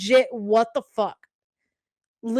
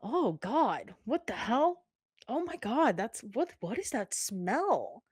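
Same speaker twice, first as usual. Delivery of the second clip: hushed grossed-out murmur; quiet disgusted whispering of the class; softer mock disgust; 8 dB quieter than usual. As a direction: imitate girls muttering in disgust. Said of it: "you can hear people go".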